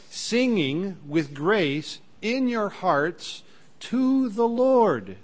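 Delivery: rising, ringing scripture read that swells on the last words; fervent Bible reading, slowing at the end. Acted. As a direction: read it out as holy text, building to the Lord.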